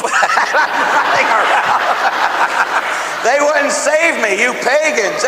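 laughing loud and high through the words. Real, not acted. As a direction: crack up while telling the silly dream. Laughing through mafia dream.

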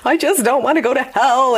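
a mild echo of tearful pleading; retold sobbing. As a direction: relive the sobbing fear of hell, lightly. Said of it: "sobbing in our bathroom".

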